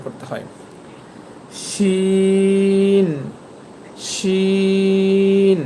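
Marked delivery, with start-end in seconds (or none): 1.7-5.6 শিন held long with a sh from mid-tongue; Arabic letter demo. Shown as a demonstration. Teacher showing how শিন sounds. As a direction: draw out শিন slowly. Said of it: "এই শিন হরফটিও উচ্চারণ করতে হবে".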